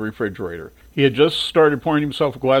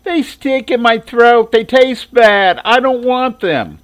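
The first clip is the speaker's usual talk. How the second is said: high, slow, louder whine; whiny complaining; 10 semitones higher, 7 dB louder, slower than usual. Acted. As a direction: gripe about the pills like a sulky kid. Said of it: "she would gripe and complain".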